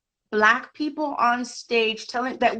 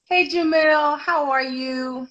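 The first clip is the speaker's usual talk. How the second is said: higher, louder cheerful hello; bright greeting; 7 semitones higher, 4 dB louder, slower than usual. Real, not acted. Guest greeting the host on arrival, cheerful hello. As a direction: greet him with a lift of delight.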